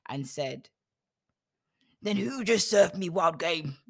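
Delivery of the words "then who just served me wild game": raised, louder shocked demand; hammed-up shock, voice higher and louder; trembling outrage. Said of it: "began to tremble uncontrollably".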